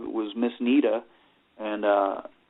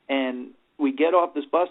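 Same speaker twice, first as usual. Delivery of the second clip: normal talking voice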